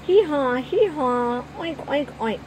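loud high hee-haw then oinks; kids' animal game, hammed-up noises; mock donkey and pig. A narrator's reading. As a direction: bray then grunt playfully. Children join the animal game.

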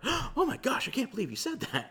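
a hammed-up shocked gasp; mock shock. Shocked reaction imagined for Jesus.

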